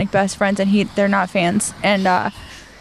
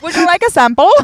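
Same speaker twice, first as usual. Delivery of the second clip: louder, high mock Australian accent; 6 semitones higher, 6 dB louder than usual. Offering samples at work, mock Australian accent. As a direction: put on a joke Australian accent, projected high. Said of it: "And I picked Australian".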